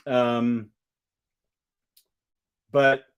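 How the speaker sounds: ordinary talk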